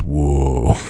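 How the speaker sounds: low, drawn-out mock "whoa"; shocked audience. Podcaster imitating theatre audience after mean line, mildly shocked. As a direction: imitate a stunned crowd, understated. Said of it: "in shock".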